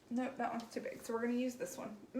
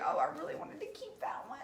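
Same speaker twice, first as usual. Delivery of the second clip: wistful inner voice; 10 semitones higher, faster than usual. Retold or imitated. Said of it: "I'm like".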